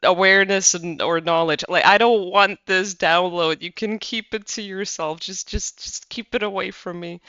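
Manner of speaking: defiant refusal. Retold or imitated. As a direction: drag it out like a stubborn refuser. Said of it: "where it's like".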